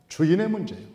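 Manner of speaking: ordinary talk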